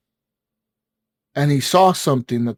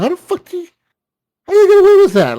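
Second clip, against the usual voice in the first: high, far put-on voice of disbelief, getting louder; incredulous outburst; 5 dB louder, much faster than usual. Friend recounting murders, mock outraged disbelief.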